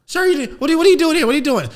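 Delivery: put-on high, loud panicky child voices; alarmed kids. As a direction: squeal it like frightened kids. Kids questioning the angry dad.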